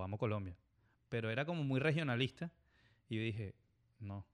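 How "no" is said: usual speaking voice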